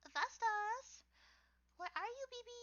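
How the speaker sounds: like calling a pet to come out; high sing-song call. Pretend. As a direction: call the name up high, coaxing.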